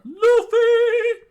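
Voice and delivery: very high, very loud cry of a name; doctor screaming as the tower is hit; horrified scream. Acted. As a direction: scream his name in horror. Kureha sees a direct hit.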